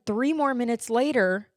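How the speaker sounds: ordinary talk, nothing marked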